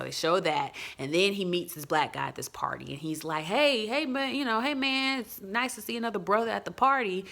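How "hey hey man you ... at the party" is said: higher-pitched acted friendly hello; friendly greeting. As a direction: imitate an eager greeting to a fellow guest.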